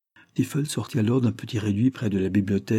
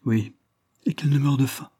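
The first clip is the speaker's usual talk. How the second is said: choked with tears; 6 semitones higher than usual. A narrator's reading. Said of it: "que ses larmes étouffaient".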